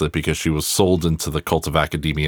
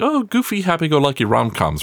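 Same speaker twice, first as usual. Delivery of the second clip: much higher, sing-song sarcastic gush; mock gushing; 11 semitones higher than usual. Host mocking the idea that rom-coms are harmless, sarcastic high gush. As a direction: parody a naive fan cooing over rom-coms.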